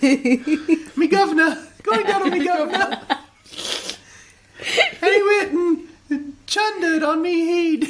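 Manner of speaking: very high mock-British voice, close to laughing; giggly falsetto British caricature; British accent. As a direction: squeak it out as a hammed-up Brit. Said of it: "should we do it in a British accent?".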